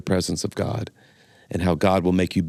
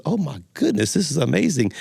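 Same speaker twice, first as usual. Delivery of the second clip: amazed joke character; 7 semitones higher than usual. Acted. Thibodeau marvels at the talking dog, amazed. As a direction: play the astonished buyer.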